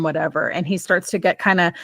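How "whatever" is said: ordinary delivery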